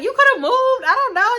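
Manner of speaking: high-pitched imitation of her; mock friend. Friend making excuses to her, high mocking voice.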